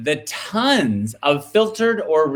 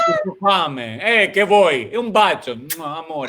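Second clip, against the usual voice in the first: put-on over-the-top Italian accent, climbing to a shout; hammed-up Italian; 4 semitones higher than usual. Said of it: "I love your Italian accent".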